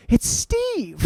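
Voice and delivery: high excited squeal of recognition; starstruck squeal. Retold or imitated. Girlfriend spotting the actor, high starstruck squeal. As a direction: squeal his name like a starstruck fan.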